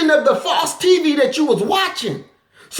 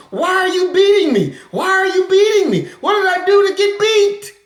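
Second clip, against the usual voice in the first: pleading victim; 7 semitones higher than usual. Retold or imitated. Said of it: "and she".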